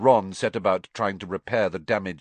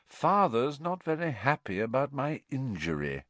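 higher-pitched feigned regret; mock sorrow; 6 semitones higher than usual. A narrator's reading. Malfoy bringing up his father's displeasure, mock sorrow. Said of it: "in a tone of mock sorrow".

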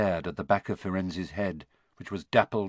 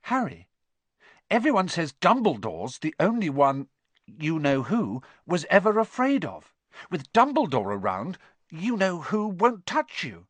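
put-on higher-pitched girl, earnestly reassuring; Hermione; 7 semitones higher than usual. A narrator's reading. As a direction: comfort a frightened friend, settling at the end.

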